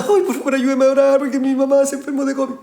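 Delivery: high, whiny mock sob; mock crying plea. Preacher mocks a crying member asking for prayer. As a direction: imitate a tearful church member, high. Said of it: "llegan llorando".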